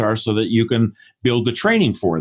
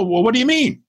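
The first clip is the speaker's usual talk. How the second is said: puzzled challenge; 12 semitones higher, faster than usual. Retold or imitated. Acted puzzled client challenging consultant.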